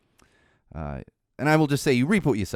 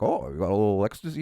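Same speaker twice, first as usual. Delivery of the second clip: joking. Retold or imitated. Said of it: "and jokingly".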